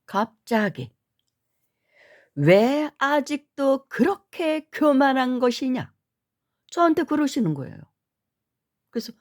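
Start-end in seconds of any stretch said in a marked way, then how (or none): 2.3-5.9 higher, slower mock scolding of a monk; retold scolding. Monk rebuking a proud visitor, slow scolding. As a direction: thunder it down slowly like a stern monk. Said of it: "호통을 치시는 것입니다".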